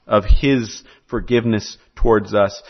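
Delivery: ordinary talk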